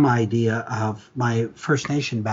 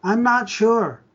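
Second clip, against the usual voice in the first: put-on much higher, little-girl voice; small child voice; 9 semitones higher, slower than usual. Little girl tells caller about mommy, childlike. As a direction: play the little girl on the phone. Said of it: "The voice of a small child".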